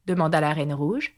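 ordinary delivery, nothing marked

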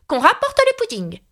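high commanding cry; cried out. A narrator's reading. Alice trying out giving orders, a high commanding cry. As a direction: order it back like a queen would. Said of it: "s'écria".

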